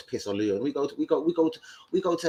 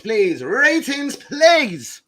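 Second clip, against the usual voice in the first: loud, higher begging; mock plea; 10 semitones higher, 8 dB louder, much slower than usual. Asking viewers for ratings, loud mock begging.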